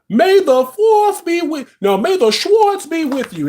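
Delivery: high, slow hammed-up movie line; Spaceballs movie quote. Quoting Spaceballs to her viewers, high hammed-up voice. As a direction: ham up the Schwartz line. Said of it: "If y'all ever seen Spaceballs".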